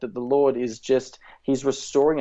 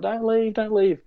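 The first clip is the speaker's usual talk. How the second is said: put-on high, pleading cry of a little boy; pleading child; 9 semitones higher than usual. Boy begging his crying dad, high and pleading. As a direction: beg him not to go, small and high. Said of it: "and I'm going".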